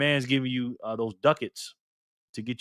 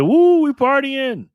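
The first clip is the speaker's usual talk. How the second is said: mock party whoop; 12 semitones higher, 8 dB louder, much slower than usual. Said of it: "Party P said".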